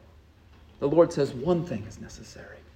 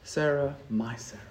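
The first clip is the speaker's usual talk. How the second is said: soft and low, lingering on Sarah; tender, intimate; 4 semitones lower, 6 dB quieter than usual. Shown as a demonstration. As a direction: say my Sarah like a loving husband. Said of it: "It's different than when I say".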